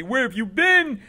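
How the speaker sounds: high, loud, slow outraged yelling; screaming boss. Acted. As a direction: build to a drawn-out furious scream. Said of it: "is screaming at me".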